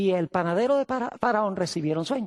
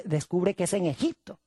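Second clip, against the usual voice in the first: ordinary talk, nothing marked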